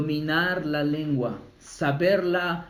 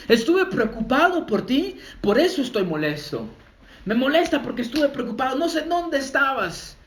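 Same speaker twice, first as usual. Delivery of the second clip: higher-pitched mock parent scolding; worried parent scolding; 6 semitones higher than usual. Lightly voiced parent scolding a late child, higher and pleading. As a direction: play the upset parent excusing the anger. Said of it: "empieza a gritar el padre de familia".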